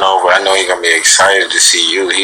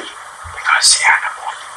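soft whispered goodbye; 6 dB quieter than usual. Shown as a demonstration. Dad whispers goodnight to the viewers, hushed whisper. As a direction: breathe it out in a whisper.

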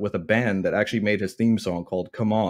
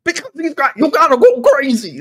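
very high, strained, louder, laughing at the end; failed Piper impression; 9 dB louder than usual. Host attempting Piper for his co-host, high and strained. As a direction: squeal it like a man getting electrocuted. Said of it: "I wanted to try a Piper impression".

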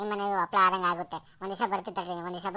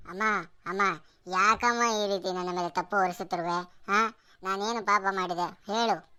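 ordinary talk, nothing marked

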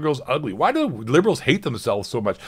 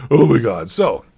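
ordinary talk

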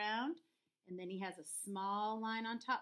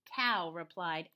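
usual speaking voice

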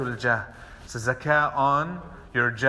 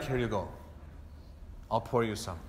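gently offering; 6 semitones lower, 10 dB quieter than usual. Shown as a demonstration. Host bringing water to guest.

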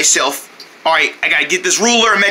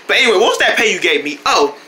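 usual speaking voice

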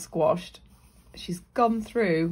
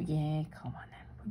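whispered aside; 3 semitones lower, 9 dB quieter than usual. Real, not acted. Playful aside.

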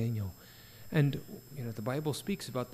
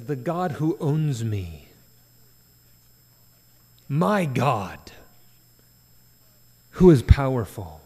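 full-force preaching; 10 dB louder, slower than usual. Real, not acted. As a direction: declare it with rising force. Preaching Daniel's words at full force.

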